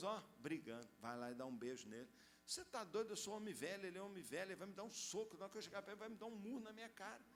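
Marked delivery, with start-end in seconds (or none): 2.5-7.2 arguing with God. Retold or imitated. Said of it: "ficou brigando com Deus".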